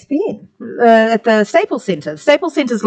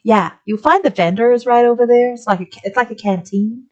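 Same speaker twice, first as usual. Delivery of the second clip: put-on perky, higher-pitched American accent; American attendant; 4 semitones higher than usual. Attendant directing a tourist to the canteen, bright American accent. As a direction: give directions as a helpful American. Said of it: "That's what I got back from her".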